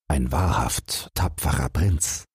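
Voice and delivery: plain reading voice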